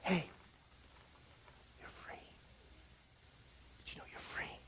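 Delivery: barely audible whispered nudge; hushed urging. Acted. Voice telling a captive they're free, hushed. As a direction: whisper it, coaxing someone to notice.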